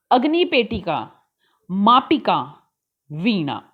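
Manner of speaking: each word set apart by pauses, said for repeating; teacher modelling each word; model pronunciation. Shown as a demonstration. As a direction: pause after every word so pupils can echo it. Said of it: "चित्र देखकर हमें इन शब्दों का उच्चारण करना है".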